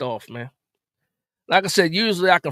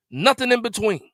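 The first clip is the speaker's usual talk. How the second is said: ordinary delivery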